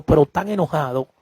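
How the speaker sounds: ordinary talk, nothing marked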